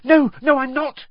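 quick flustered denial; hastily. Acted. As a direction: hurry to deny it. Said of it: "she said hastily".

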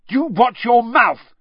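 high, loud, drawn-out warning shout; angry shout. A narrator's reading. Ron shouts at Malfoy over an insult, shouting. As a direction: shout him down in anger. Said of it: "shouted Ron".

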